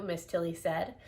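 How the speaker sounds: plain reading voice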